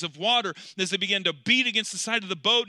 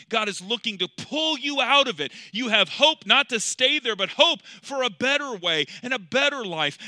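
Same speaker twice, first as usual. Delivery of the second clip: higher-pitched, forceful pulpit delivery; impassioned preaching; 4 semitones higher, 4 dB louder than usual. Real, not acted. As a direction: preach hope with building urgency.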